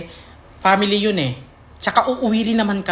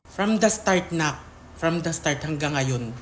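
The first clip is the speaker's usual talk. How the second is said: ordinary talk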